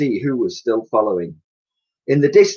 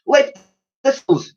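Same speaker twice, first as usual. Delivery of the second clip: calling out; 11 semitones higher, 4 dB louder than usual. Acted. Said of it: "she called out".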